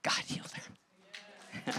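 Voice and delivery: slow, breathy whisper of wonder; a real hushed moment of awe; awed whisper. Real, not acted. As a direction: let it drop to a reverent whisper. Awed whispered testimony climax.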